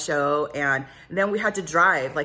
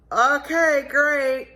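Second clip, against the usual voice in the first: forced cheer; 9 semitones higher, 7 dB louder, much slower than usual. Retold or imitated. Feigned cheer answering the odd voice.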